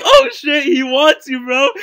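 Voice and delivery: high, loud teasing outburst; imitating cracked-up friend. Classmate teasing his stared-at friend, loud and cracking up. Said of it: "he starts bawling".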